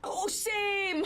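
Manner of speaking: high, loud burst of enthusiasm; excited agreement. Real, not acted. Viewer agreeing about wanting to drink the candle, excited. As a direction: blurt it out high and delighted.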